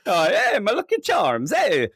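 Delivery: high, lilting cartoon Irish accent; mock Irish accent. Predator mimicking a cereal ad, hammed-up Irish accent. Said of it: "do an Irish accent".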